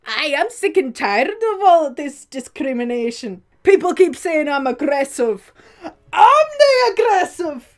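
very loud, high Northern Irish rant; indignant Northern Irish shouting, hammed up; Northern Irish accent. Shown as a demonstration. Sketch character protesting discrimination, shouted Northern Irish accent. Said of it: "The people of Northern Ireland".

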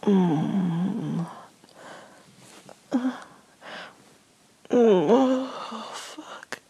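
plain reading voice

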